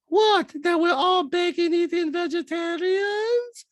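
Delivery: very high, drawn-out incredulous impression; mock castmate falsetto. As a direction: squeal the question in mock disbelief. Acted Diana interrupting in the quarrel.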